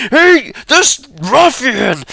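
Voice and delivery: high, slow indignant cry; indignant accuser. Acted. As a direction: denounce him to the guards. NPC denouncing ruffian.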